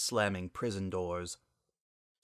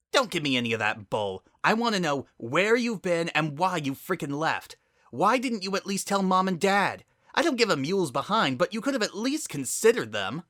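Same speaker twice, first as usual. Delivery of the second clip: higher, louder angry scold; furious scolding; 9 semitones higher, 4 dB louder than usual. Acted. Spitfire scolding Sunset for leaving, a higher, louder furious rant. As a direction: let the anger pour out.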